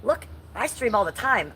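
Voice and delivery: ordinary delivery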